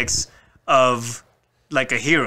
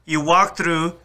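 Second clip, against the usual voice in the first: ordinary talk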